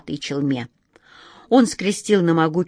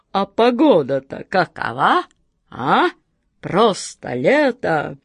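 довольный джинн; slower than usual. Acted. Джинн радуется погоде.

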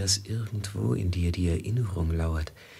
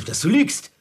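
very high, loud accusing cry; angry outburst; 7 dB louder than usual. Acted. Traveller crying out that the woman lies. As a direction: let the accusation explode. Accusation climax in tense scene.

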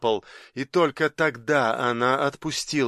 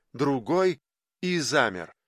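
plain reading voice

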